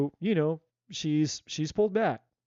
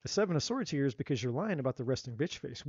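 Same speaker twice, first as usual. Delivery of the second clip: normal talking voice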